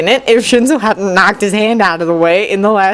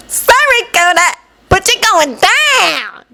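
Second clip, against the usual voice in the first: high, cocky and slow; bubbly girl taunting; confident exclamation; 13 semitones higher, slower than usual. Acted. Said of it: "Mina exclaimed assuredly".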